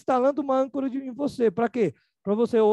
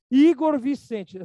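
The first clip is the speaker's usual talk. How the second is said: slow, higher, louder call of the full name; stern mother calling; 4 semitones higher, 6 dB louder, much slower than usual. Retold or imitated. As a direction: draw out Igor Vicente like a mother about to scold. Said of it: "minha mãe falar nesse tom de voz". (mãe brava chamando o filho pelo nome completo)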